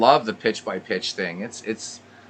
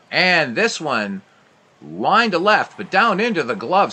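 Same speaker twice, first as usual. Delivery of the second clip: rising, much louder call of the hit; higher, louder excited radio call; 8 semitones higher, 8 dB louder than usual. Acted. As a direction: lift the voice as the ball flies. Base hit called live.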